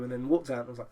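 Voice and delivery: ordinary talk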